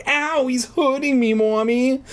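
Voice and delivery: put-on high, whiny little-girl voice; hurt child. Little girl caught by the dummy to her mother, hurt whine. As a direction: whine to Mommy in pain, pitched high.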